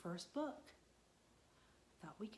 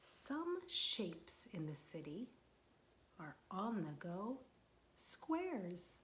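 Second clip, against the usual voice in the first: slow, gentle picture-book reading; reading to children; slower than usual. Acted. Teacher reading a picture book aloud to children, slow and gentle. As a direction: read it slowly to the little ones.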